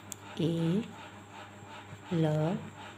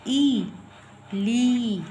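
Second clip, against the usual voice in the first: ई and ली higher and loud; stressed ee; 4 semitones higher, 6 dB louder, much slower than usual. Shown as a demonstration. Teacher to class, stressing the long ई. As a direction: punch the ई in ली hard. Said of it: "ज़्यादा stress दे के बोल रहे हैं".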